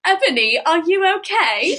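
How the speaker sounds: lilting attempt at a British accent; Hermione. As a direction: do a mock British schoolgirl accent. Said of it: "Do the Hermione voice".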